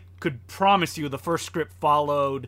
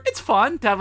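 usual speaking voice

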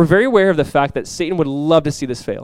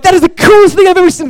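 high, loud awestruck exclamation; amazed excitement; 11 dB louder than usual. Retold or imitated. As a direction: gush loudly over something astonishing. Retelling his amazed reaction.

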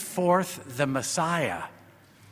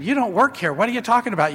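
incredulous, higher and louder, lightly acted; retold disbelief; 6 semitones higher, 5 dB louder, faster than usual. Priest thinking to himself at the woman's outburst, incredulous. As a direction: act out the baffled thought.

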